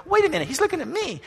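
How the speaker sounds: high, eager wait-a-minute wonder; amazed saint. Acted. Another saint claiming Jesus's gaze, high and eager. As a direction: catch your breath, he means you. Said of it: "someone standing here goes".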